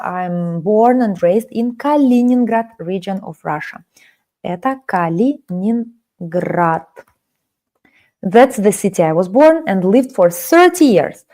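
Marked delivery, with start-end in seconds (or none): 4.4-6.9 slow clear naming. Shown as a demonstration. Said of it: "teacher shows city name".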